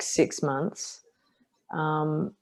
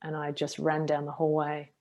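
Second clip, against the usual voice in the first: ordinary talk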